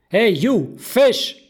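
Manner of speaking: high, loud, exasperated hail; really annoyed shout. Acted. Kat hailing the fish who ignores her, really annoyed. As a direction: holler at someone ignoring you. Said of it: "Kat, who is really annoyed".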